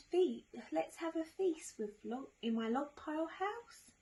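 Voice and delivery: high-pitched storybook snake; high, playful snake invitation; snake character. Acted.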